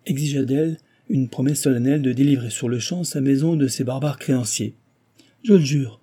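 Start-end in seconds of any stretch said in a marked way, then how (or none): 5.4-6.0 emphatic, loud, raised vow; vigorous exclamation; energetic cry. A narrator's reading. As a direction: swear it with force. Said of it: "s'écria-t-elle avec énergie".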